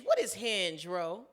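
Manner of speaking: normal talking voice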